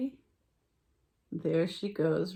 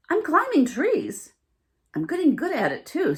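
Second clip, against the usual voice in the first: high, loud boast; proud little raccoon; 7 semitones higher, 7 dB louder than usual. A narrator's reading. Raccoon telling the fawn he climbs trees, high and proud. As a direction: brag sweetly about climbing. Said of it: "said the little raccoon".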